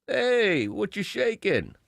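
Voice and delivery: high, drawn-out catcall voice; construction worker catcaller. Retold or imitated. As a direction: holler it like a street catcaller. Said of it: "I'm trying to do my dad as a, as a construction worker, cat caller".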